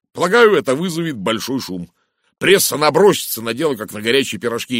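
loud, animated gossip; emphatic, blustering talker; Blatt character voice. A narrator's reading. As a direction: hold forth with scornful relish.

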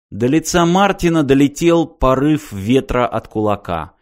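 plain reading voice

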